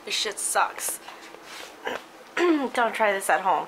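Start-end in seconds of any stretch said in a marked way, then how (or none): 2.4-3.7 low, pained warning; strained through the burn; after eating wasabi. Real, not acted. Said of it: "My nose is on fire".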